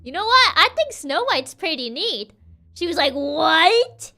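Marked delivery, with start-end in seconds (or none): 0.0-2.3 loud, higher, casual mirror. Acted. The mirror answers the witch. 3.1-4.1 loud, rising to very high; the witch can't believe it; outraged witch. Acted. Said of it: "witch voice is so good".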